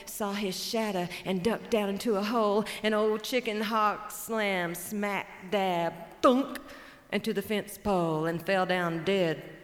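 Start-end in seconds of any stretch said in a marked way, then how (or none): 6.2-6.6 sharp, loud, high thunk of impact; loud thunk sound effect. Acted. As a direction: smack the sound out like a collision. Hawk slams into pole.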